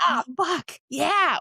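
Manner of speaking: acted burst of triumph; elated reaction. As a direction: celebrate great sales numbers. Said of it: "You either go".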